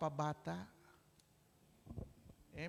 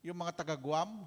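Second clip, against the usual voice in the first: normal talking voice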